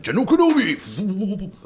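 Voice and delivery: high, slow drawn-out robot villain greeting; General Grievous. Retold or imitated. Mimicking the Lego Grievous toy, high and slow. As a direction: stretch it like Grievous. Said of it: "he's like all like".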